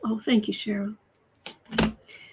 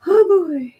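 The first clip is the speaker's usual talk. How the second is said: higher and louder burst; excited exclaim; 8 semitones higher, 6 dB louder than usual. Real, not acted. Reacting to her cat, excited loud exclaim. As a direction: let it out in a delighted burst.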